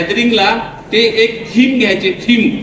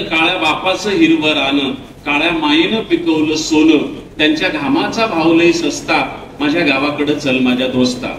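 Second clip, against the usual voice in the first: lower, measured reciting voice; poem recitation; 5 semitones lower than usual. Acted. Poet recites his farmer poem to the schoolchildren, lower and measured. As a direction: recite it like a rural folk poem.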